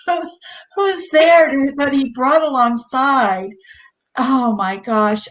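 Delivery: ordinary delivery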